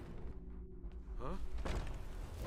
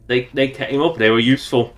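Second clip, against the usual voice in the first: ordinary delivery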